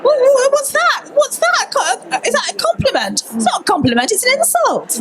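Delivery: high-pitched, incredulous questioning; indignant disbelief. Real, not acted. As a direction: protest in a high, half-laughing squeal. Mock reaction to backhanded remark.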